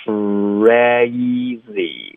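CRAZY stretched, pouted kr opening wide into "eh-ee"; relaxed open mouth. Shown as a demonstration. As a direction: draw out crazy, dropping the jaw on the vowel. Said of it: "要放松张嘴". (张嘴夸张慢读 crazy)

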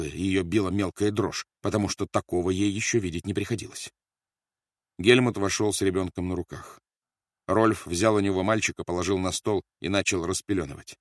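usual speaking voice

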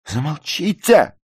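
very high, loud, drawn-out squeal; shriek. A narrator's reading. Ruth screams at the narrator to stop, shriek. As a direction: shriek to cut them off. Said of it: "взвизгнула Рут".

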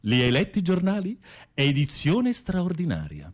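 hammed-up newsboy headline; mocking taunt. Taunting his cellmate, mock-announcing the newspaper headline.

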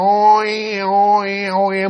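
high, drawn-out droning "oi"; sustained insect drone on one high note; imitated mosquito whine. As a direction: mimic a mosquito buzzing by. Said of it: "muỗi như vậy nè".

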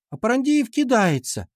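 put-on high girlish telltale whine; whining tattle. A narrator's reading. Girl complaining of thrown eraser, high whine. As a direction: tell on him in a high whine. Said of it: "тут же заныла Дана".

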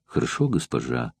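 a servant's muffled reply through the door; a hint of a distant slave girl. A narrator's reading. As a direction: answer meekly from behind the door. Slave answers behind the door.

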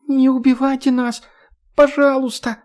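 put-on high, thin little girl's plea; bleating plea. A narrator's reading. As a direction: bleat it pitifully like a small girl. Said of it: "жалобным голоском проблеяла". (жалобным детским голоском молит о пощаде)

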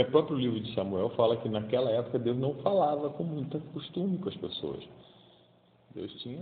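ordinary talk

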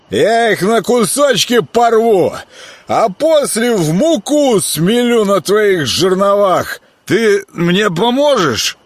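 put-on loud, slow drunken boasting; drunk slurring. A narrator's reading. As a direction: slur the threat like a drunk brawler. Заплетающимся языком.

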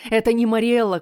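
loud, high pleading cry to his father; desperate plea. Acted. Prince begs his father. (отчаянная мольба)

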